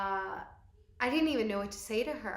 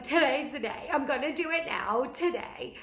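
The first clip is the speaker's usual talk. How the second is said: psyching up; 6 semitones higher, 6 dB louder than usual. Retold or imitated. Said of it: "I was like".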